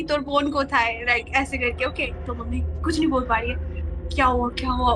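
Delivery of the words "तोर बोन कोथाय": Bengali words, lightly voiced grandmother; Bengali line. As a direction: slowly say तोर बोन कोथाय in Bengali. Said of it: "Bengali में बोला कि". (बंगाली में नानी का हल्का सा सवाल)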